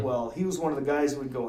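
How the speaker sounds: ordinary talk, nothing marked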